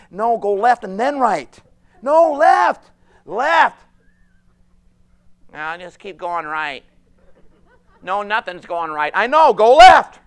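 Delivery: high, loud insistent calls; urgent guiding voice, rising to a shout; God calling directions. Acted. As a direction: shout directions to someone lost. God's voice directing lost people.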